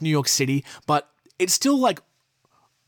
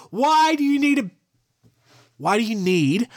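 much higher, incredulous why; exasperated outburst; 7 semitones higher than usual. Real, not acted. Host ranting about a bear kept at a gas station, exasperated and much higher. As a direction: burst out in disbelief.

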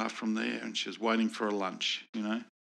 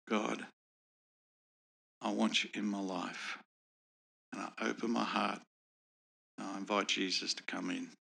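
simple prayer; 6 dB quieter than usual. Retold or imitated. Models a simple prayer for her.

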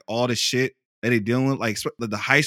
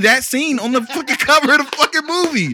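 excited; 12 semitones higher, 6 dB louder than usual. Real, not acted. Excited arguing about the scene.

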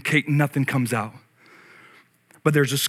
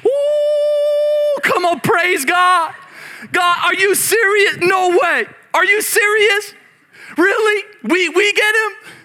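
picture Zechariah bursting with joy at the news; overjoyed father-to-be, shouting praise to God; excited praise; 11 semitones higher, slower than usual. Pretend. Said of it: "you would think Zechariah would hear this and say".

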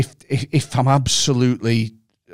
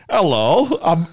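high, hammed-up fake Korean accent; overdone put-on Korean accent for a laugh; cartoonish Korean accent, pitched up; mock Korean accent; 8 semitones higher than usual. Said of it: "you can't be like".

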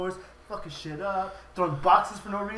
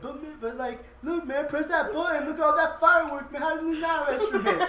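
much higher mock pleading voice; pleading suspect; 9 semitones higher, 4 dB louder than usual. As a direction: whine it like a cornered guy, hammed up. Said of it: "He's like".

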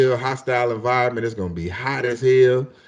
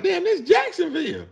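amazed; 3 dB quieter than usual. Retold or imitated. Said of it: "I was like".

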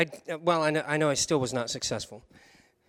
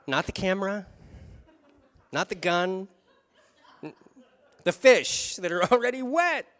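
laughing punchline; 6 semitones higher, 8 dB louder than usual. Real, not acted. Telling congregation about saving the fish, laughing. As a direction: laugh out the absurd bit.

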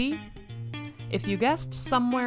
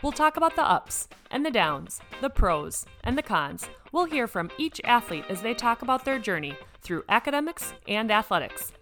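podcast intro; 4 semitones higher than usual. Acted. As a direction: announce the show like a host.

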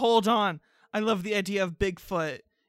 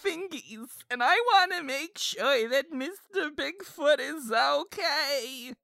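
higher, slow doting grandma; 5 semitones higher than usual. Acted. Grandma worried about Mister Bigfoot, higher and doting. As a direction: play the doting old grandma.

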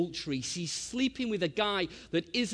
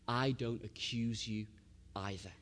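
gentle absolution; 7 semitones lower, 7 dB quieter than usual. Retold or imitated. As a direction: quietly let her off without judgement. Jesus gently pardoning the woman.

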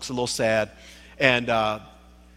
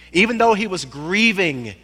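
usual speaking voice

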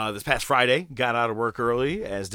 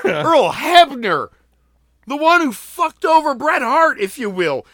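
incredulous; 9 semitones higher, 7 dB louder than usual. Real, not acted. Incredulous rant about Hebner.

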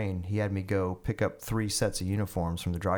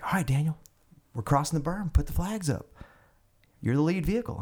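higher, commanding colonel voice; colonel's order; 7 semitones higher than usual. Retold or imitated. Colonel telling Daniel to raise the flags, commanding. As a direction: bark it like the boss giving the order.

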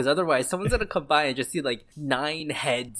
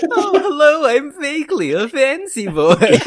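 very high, hammed-up fancy boy; Fakely; 10 semitones higher, 5 dB louder than usual. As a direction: play a mock fancy boy, drawing out the last words. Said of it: "makes him sound like a fancy boy".

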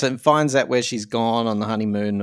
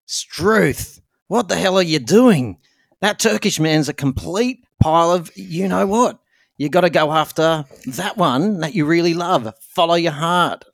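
hammed-up Aussie accent, higher swooping pitch; Australian accent; 3 semitones higher than usual. Shown as a demonstration. Co-host demonstrating Kylie's advice to the heroine, hammed-up Australian accent. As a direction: put on a broad, mock Australian voice. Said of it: "I can do that for you".

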